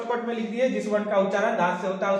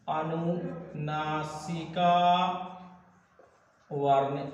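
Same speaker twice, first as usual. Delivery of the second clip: "अनुनासिका" very drawn out, softer, then a pause; syllable-by-syllable dictation; 3 semitones lower, 4 dB quieter, much slower than usual. Real, not acted. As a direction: stretch "अनुनासिका" slowly, syllable by syllable. Teacher stretches term out for writing. ("अनुनासिका" को खींचकर धीरे लिखवाना)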